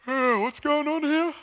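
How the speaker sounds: high, slow words through a yawn; stretching yawn. Acted. Jesus waking from his nap in the boat, yawning. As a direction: talk mid-stretch, just woken up. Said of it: "a big yawn".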